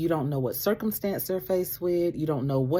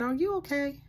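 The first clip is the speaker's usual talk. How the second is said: high, pointed are you okay; gently probing question; concerned check-in; 10 semitones higher than usual. Retold or imitated. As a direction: lift it with mock concern. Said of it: "Like that".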